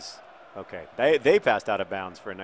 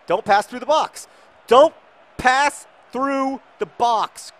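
high, loud, increasingly drawn-out yell; urgent command; 13 semitones higher, 12 dB louder, slower than usual. Real, not acted. Manager warning players off the box, urgent shouting. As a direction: hammer out each word at a higher pitch. Ordering players during match.

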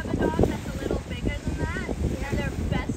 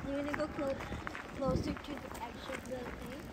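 soft coaxing; 2 semitones lower, 11 dB quieter than usual. Real, not acted. Coaxing dog into water.

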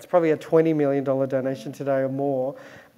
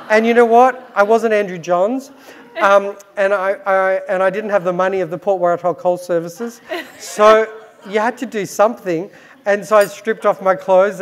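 louder, excited build-up; 4 semitones higher, 6 dB louder than usual. Real, not acted. Builds to the punchline, laughing.

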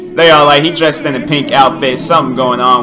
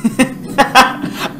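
unvoiced burst of laughter; shocked laughter; 9 semitones higher than usual. Real, not acted. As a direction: laugh in disbelief at what you see. Laughs at video.

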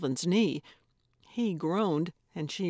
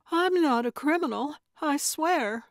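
put-on protesting young woman; 8 semitones higher than usual. A narrator's reading. Actress insisting to the old woman, higher and pleading. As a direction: insist earnestly, a little alarmed.

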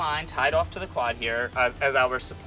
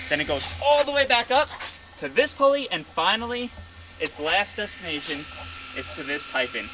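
breathless from the long explanation; running out of air mid-sentence; out of breath; 4 semitones higher than usual. Real, not acted. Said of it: "I am out of breath after saying that".

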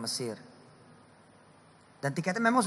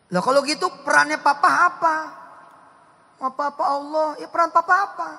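put-on high, loud questioning child; acted child's question; 11 semitones higher, 8 dB louder than usual. Child asking father about his role, high and loud. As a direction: ask like a puzzled kid. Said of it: "papa, jangan papa".

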